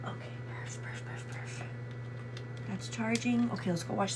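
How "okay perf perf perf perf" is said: whispered. Real, not acted. Whispering to herself while fixing.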